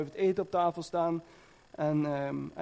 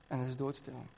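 much softer, barely voiced; almost whispered; 5 semitones lower, 10 dB quieter than usual. A narrator's reading. Preacher echoes the barn's dead silence, nearly inaudible. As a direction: let it fade into the silence.

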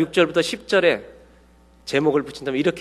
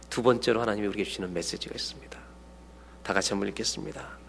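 soft, unvoiced whisper; 6 semitones lower, 10 dB quieter than usual. Real, not acted. Preacher hushes to announce the next message, whispered. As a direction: lean in and whisper the point. Sermon aside before reading.

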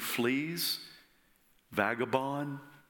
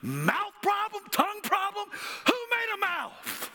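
angry God. Retold or imitated. God scolding Moses for his excuses, high angry shout. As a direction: hurl the challenge back at him. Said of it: "God got angry at Moses".

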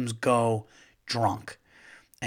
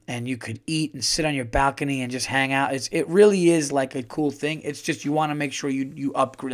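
usual speaking voice

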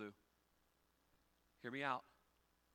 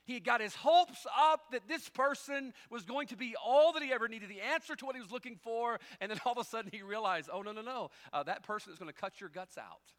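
loud, high, driving sermon delivery; preaching at full force; 9 semitones higher, 12 dB louder than usual. Acted. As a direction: preach it out, building energy.

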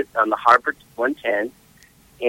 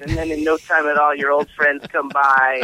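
usual speaking voice

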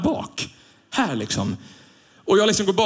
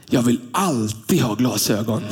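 put-on little boy voice; 6 semitones lower, slower than usual. Little son tells grandfather.

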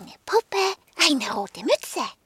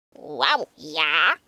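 low woofing dog; 9 semitones lower than usual. Acted. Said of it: "nickte Sokrates".

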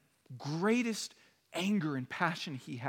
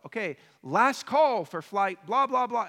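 calling out; 6 semitones higher, 9 dB louder than usual. Retold or imitated. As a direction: call the last boarding loudly. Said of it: "she calls out".

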